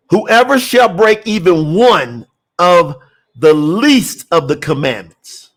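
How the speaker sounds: slow, weighted reading, dropping low at the end. Real, not acted. Preacher restarting the verse for the congregation, slow reading. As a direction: spell the verse out slowly for everyone. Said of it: "I'm gonna read it slow".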